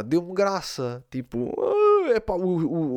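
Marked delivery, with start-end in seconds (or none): 1.6-2.1 high, loud mock wail; imitated wailing moan. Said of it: "aquele gemido deu-me graça". (gemido de choro imitado)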